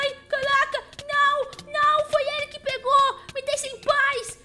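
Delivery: scared pleading. Real, not acted. Chased by monsters in game.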